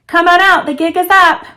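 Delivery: loud, high call into the dark. Acted. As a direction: yell it at the hidden pranksters. Wes calling out to hidden pranksters.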